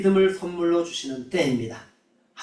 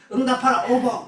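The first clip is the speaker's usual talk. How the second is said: high-pitched playground taunt, imitated with a laugh; mock teasing; 7 semitones higher than usual. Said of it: "학교에서 놀림 받았다는".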